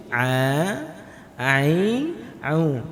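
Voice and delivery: A, ai, au with a thin ain from mid-throat; teacher drilling a, ai, au; light ain. Shown as a demonstration. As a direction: keep the ain light, tongue slightly back. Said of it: "lidah kita seolah-olah agak mundur".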